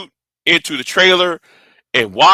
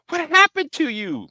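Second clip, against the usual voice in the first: much higher, softer voice for the uncle; imitated concerned uncle; 12 semitones higher, 6 dB quieter than usual. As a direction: play the uncle asking, high and hushed.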